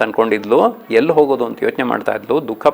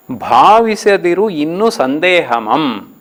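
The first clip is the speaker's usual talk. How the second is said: slow verse reading, leaning on ಭಾವಿಸದಿರು; poem recitation; 4 semitones higher, slower than usual. Shown as a demonstration. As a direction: chant the old line slowly. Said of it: "ಹದಿನಾರನೇ ಪದ್ಯ". (ನಿಧಾನ ಪದ್ಯ ವಾಚನ)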